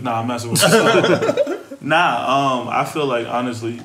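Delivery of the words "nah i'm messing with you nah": loud laugh while taking it back. Real, not acted. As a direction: giggle out the retraction. Laughs off the joke.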